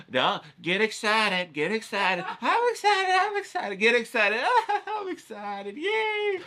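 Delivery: very high, giddy squeals of excitement; a silly inner voice bouncing with glee; squealing self-pep talk. Retold or imitated. Psyching himself up before painting, giddy high squeals. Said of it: "doing my mental thing".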